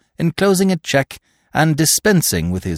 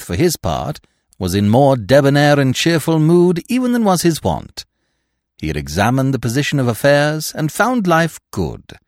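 usual speaking voice

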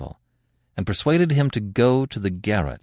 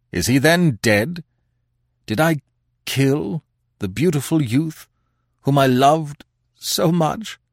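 louder, higher anguished cry; Pierre's horror; 9 semitones higher, 5 dB louder than usual. Acted. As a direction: let the horror break out.

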